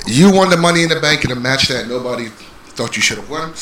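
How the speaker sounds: ordinary delivery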